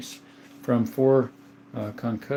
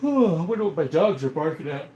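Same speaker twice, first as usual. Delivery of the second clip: yawning; 5 semitones higher than usual. Real, not acted. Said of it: "Big yawn".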